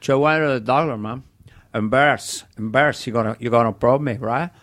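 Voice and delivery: put-on Tony Montana Cuban accent at the doctor; embarrassed Cuban gangster voice; Scarface Cuban accent. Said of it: "as these characters".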